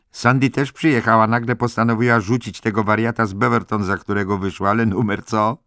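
wysoki, głośny, przejęty głos; podekscytowana dziewczyna. Acted. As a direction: oznajmij nowinę głośno, z przejęciem. Excited Candy announces her friend. (podniecona paplanina podniesionym głosem)

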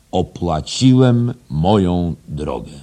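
slow, loud, drawn-out proclamation; solemn boast. Acted. Headmaster quoting the proudest English boast, slow and solemn.

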